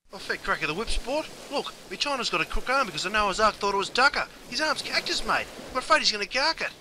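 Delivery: high, urgent Aussie alarm; panicked alarm. Acted. Bloke calling for help after a shark bite, high and urgent. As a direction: raise the alarm about a mate's arm.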